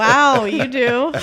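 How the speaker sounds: slow, loud echo of wonder; mock amazement. Answering the stranger back, slow mock amazement. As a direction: drag out a sarcastic wow.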